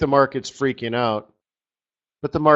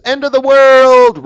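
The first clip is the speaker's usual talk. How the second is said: hammed-up cry of doom, much higher and louder; mock panic shout; 13 semitones higher, 13 dB louder than usual. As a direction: yell it like the sky is falling, in fun. Mock panic over a chart.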